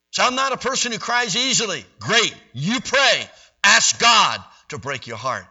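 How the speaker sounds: high, loud fervent preaching; preaching at full force. Real, not acted. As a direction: press the plea home to the congregation.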